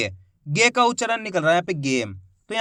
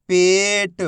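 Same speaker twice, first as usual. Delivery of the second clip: whole word in one joined, drawn-out piece; teacher's blended model reading; syllables blended; 4 dB louder, much slower than usual. Shown as a demonstration. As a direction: join the sounds into one clear word. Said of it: "आपस में मिला के पढ़ना है".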